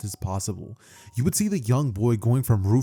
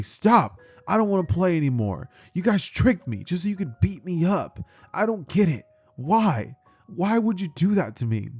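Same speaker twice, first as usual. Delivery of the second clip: Stop, voice raised in hurt; hurt shout; 6 semitones higher than usual. Acted. Naruto pleading with the kids beating him, a high hurt shout.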